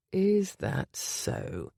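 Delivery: slow, drawn-out suggestive question. Acted. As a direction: tease her, slowly. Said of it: "slow, wicked grin".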